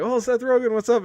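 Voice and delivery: high, eager star-struck hello; excited fan greeting. Retold or imitated. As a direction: gush like a fan spotting a celebrity. Fans greeting Seth Rogen excitedly.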